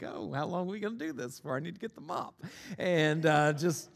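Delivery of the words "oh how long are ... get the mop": softer, quick under-the-breath grumble; muttered aside. Retold or imitated. Pastor watching the water pile up, fast muttered grumble. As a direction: complain to yourself so the kid can't hear. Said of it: "and I'm like".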